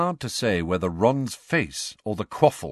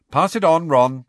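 Angelina calls across the pitch, raised and higher; calling out; 6 semitones higher, 8 dB louder than usual. Acted. Captain Angelina passing play along to Ron, calling out. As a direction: call it out brightly to a teammate. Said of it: "called Angelina".

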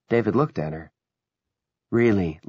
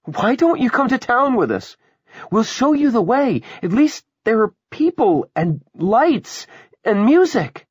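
high, loud, urgent plea; pleading woman; 7 dB louder than usual. Acted. Willa urging the passengers to come to town, pleading. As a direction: coax them desperately, voice pitched way up.

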